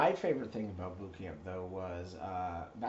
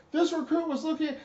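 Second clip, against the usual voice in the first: high, loud recruit reply; recruit shouting back; 8 dB louder than usual. Retold or imitated. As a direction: sound off like a scared recruit. Recruit answering.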